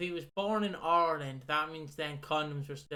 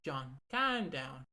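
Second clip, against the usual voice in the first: ordinary talk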